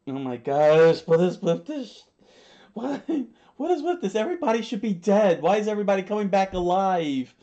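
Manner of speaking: loud, high, fast exasperated outburst; real incredulous rant. Real, not acted. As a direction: rant in laughing disbelief at the story. Reader rants at characters' revivals.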